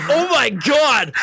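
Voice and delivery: loud, slow, drawn-out horror; disgusted coworkers. Retold or imitated. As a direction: stretch it out in shocked disgust. Coworkers reacting to smell.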